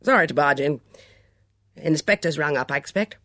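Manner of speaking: loud, gruff apology; brusque and gruff, with some force. A narrator's reading. Miss Hinchcliffe barging in on Julia, gruffly. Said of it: "in her gruff voice".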